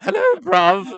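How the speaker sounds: high loud laughing London hello bruv; laughing mock London greeting; mock London accent. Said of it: "bruv is London".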